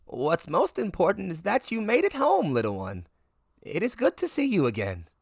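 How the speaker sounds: a lightly voiced wise old woman, gentle and knowing; sage elderly voice. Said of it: "in a very sage, elderly voice".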